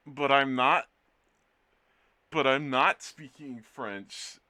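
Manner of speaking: mock protest. Joking protest to chat.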